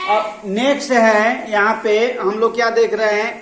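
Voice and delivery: teacher projecting loudly to a noisy class; calling out loud. Real, not acted. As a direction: raise the voice over the room.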